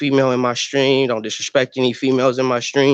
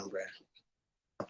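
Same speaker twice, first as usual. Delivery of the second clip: ordinary talk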